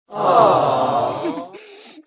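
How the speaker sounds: loud laugh bursting out through tears; relieved laugh, loud and high. Acted.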